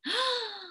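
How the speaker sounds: sharp theatrical intake of breath; mock-shocked gasp. Storyteller alarmed at the campfire, mock gasp. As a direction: gasp for the young listeners.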